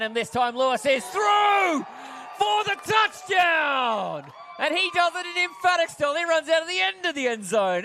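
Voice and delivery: high, excited commentator shout; touchdown call. Real, not acted. Commentator sees the runner through to score, exultant shout. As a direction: burst out as the runner breaks through.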